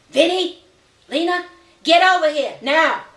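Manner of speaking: loud, high shout across the room; calling out. Acted. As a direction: bellow for the kids to come now. Mother calling kids over.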